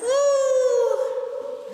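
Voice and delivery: high whoops ringing off concrete; metallic flutter echo. Real, not acted. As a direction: shout out to test the echo. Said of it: "Listen to the echo".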